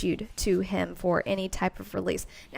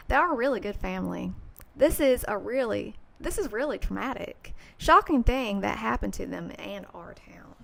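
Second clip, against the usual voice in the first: put-on Tennessee accent; 3 dB louder than usual. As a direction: quote the neighbor in a Tennessee accent. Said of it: "let me do my Tennessee accent".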